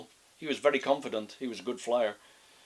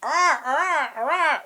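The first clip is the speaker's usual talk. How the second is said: high, loud squawking ah; high macaw squawks; 9 dB louder, much slower than usual. Acted. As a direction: squawk like a parrot. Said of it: "said a macaw".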